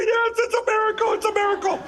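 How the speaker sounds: high, overcome cry of a miracle; reliving it, voice lifted high with joy; tearful joy. Retold or imitated. Telling the flight attendant about the miracle, overjoyed and high. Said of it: "I'm literally in tears".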